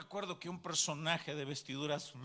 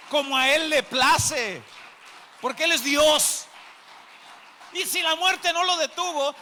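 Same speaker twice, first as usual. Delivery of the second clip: loud, raised, ringing proclamation; full-force preaching; 7 semitones higher, 11 dB louder than usual. Real, not acted. Preacher proclaiming the risen God to the congregation, impassioned shout. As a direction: build to a high shout, easing at the end.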